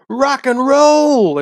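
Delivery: high, loud, drawn-out holler; calling across a bar. Retold or imitated. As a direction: holler it as a long greeting across the room. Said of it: "fifty feet away from him in the bar".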